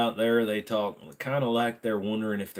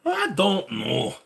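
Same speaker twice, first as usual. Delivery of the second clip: higher, slow put-on voice; goofy slow voice; 9 semitones higher, much slower than usual. Streamer shrugging off chat, slow goofy voice. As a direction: stretch it out dopey.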